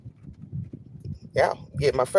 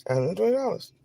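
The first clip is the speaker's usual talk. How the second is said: stunned near-whisper; 3 semitones higher, 6 dB quieter, faster than usual. Real, not acted. Quiet stunned repeat.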